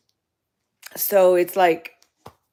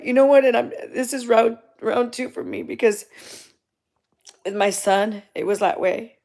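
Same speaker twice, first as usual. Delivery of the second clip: ordinary talk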